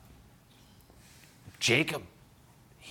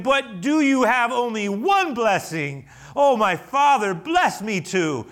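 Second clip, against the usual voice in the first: pleading; 9 semitones higher, 7 dB louder, slower than usual. Acted. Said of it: "And Esau pleaded".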